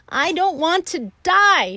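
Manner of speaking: high, very loud scream. A narrator's reading. Wilbur throws himself down, screaming high and loud. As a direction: throw it out as a shriek. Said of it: "screamed Wilbur".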